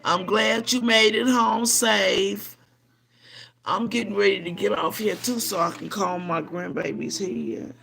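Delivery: ordinary delivery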